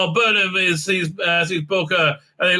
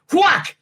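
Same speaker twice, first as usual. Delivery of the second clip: high, punchy mock fight "whack"; cartoon blow effect; shouted whack sound; 11 semitones higher than usual. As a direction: call out the hit, pitched way up. Acts out the blow.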